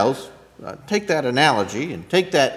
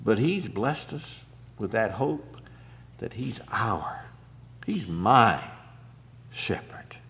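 fading to whisper; 4 semitones lower, 9 dB quieter than usual. Real, not acted.

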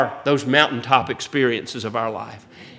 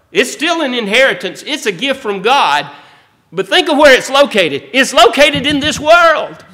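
higher and louder, building into full preaching; fervent preaching; 6 semitones higher, 4 dB louder than usual. Acted. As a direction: lift the congregation, preach it out.

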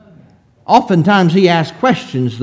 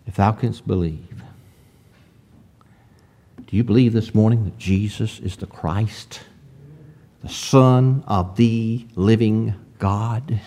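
solemn hush; 7 semitones lower, 7 dB quieter than usual. Real, not acted. Preacher putting a searching question to the congregation, solemn hush. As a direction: let the verse sink in gently.

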